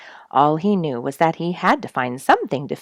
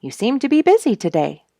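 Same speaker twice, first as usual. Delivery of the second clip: higher, louder cheerful bird greeting; lightly voiced cheery bluebird; friendly bluebird; 7 semitones higher, 6 dB louder than usual. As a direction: call down brightly to the mole. Bluebird talks to mole.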